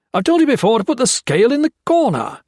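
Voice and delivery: high, loud, fast bark at the underling. Acted. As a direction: snap the order out fast and sharp. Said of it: "he barked at Blakely".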